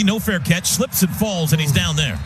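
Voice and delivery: ordinary talk, nothing marked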